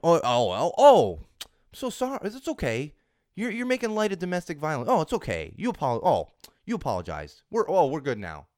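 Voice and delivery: high, loud, hammed-up simpering apology; mock them with a whiny fake sorry. Podcaster mocks Snapchat's apology, high and hammed-up. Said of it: "does it really work that way".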